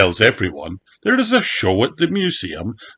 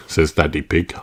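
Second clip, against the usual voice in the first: usual speaking voice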